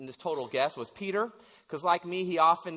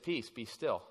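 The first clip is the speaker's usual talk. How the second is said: low, soft and unhurried, never raised; calm quiet command; 7 semitones lower, 8 dB quieter than usual. Retold or imitated. Jesus calming the storm, soft low unraised command. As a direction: settle the storm without raising the voice. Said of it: "I don't think he raised his voice much".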